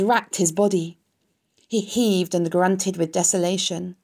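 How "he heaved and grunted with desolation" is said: plain reading voice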